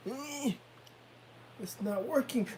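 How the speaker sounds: high, straining whine; frustrated child. Retold or imitated. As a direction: imitate a tired boy fighting his pants. Imitates tired child's frustration.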